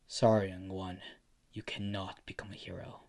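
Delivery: low, quiet, measured letdown; hushed and low, after a heavy pause; grave refusal. Acted. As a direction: break bad news softly and firmly. Hero gently refuses after long silence.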